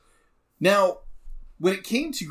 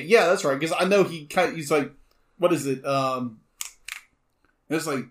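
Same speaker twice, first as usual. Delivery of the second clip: ordinary talk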